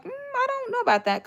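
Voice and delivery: high doubtful "mm" of disbelief; skeptical. Retold or imitated. To the listeners, high doubting about the landlady. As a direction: sound unconvinced, pitch jumping up. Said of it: "And I'm like".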